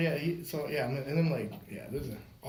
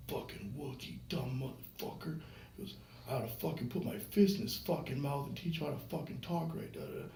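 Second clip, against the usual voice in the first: much softer, grumbled mock threat; retold angry mumbling; 7 dB quieter than usual. Drunk dad walking away from the fireman, mumbling angrily. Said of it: "you had to mumble away".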